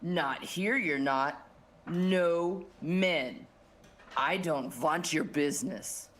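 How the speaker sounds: low, displeased boss voice; stern accented boss. Acted. Boss turning the men away, low stern refusal. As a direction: refuse them service, low and firm.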